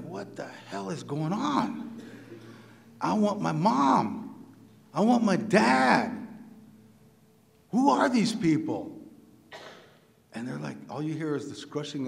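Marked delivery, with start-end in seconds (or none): 0.0-9.0 put-on higher, drawn-out child's plea; mock frightened child. Five-year-old taken from his parents by nuns, mock frightened plea. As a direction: plead slowly like a bewildered little boy.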